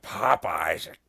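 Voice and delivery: low, drawn-out character catchphrase; Little Nicky. Retold or imitated. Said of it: "related to Little Nicky and, and his whole thing".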